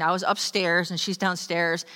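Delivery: ordinary delivery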